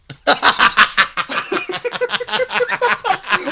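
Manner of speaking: long high-pitched laughing fit. Real, not acted. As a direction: burst into high, prolonged laughter. Laughs at own punny name.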